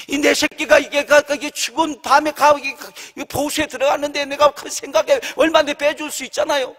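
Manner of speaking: ordinary talk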